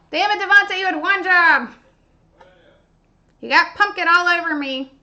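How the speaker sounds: loud, playful telling-off; hammed-up loud blame; mock scolding. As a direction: scold loudly in pretend exasperation. Scolding helper over pumpkin mess.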